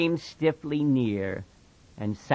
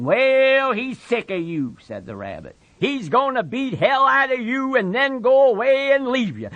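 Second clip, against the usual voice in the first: higher, louder jeering; taunting rabbit; 13 semitones higher, 6 dB louder than usual. Acted. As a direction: twist the knife.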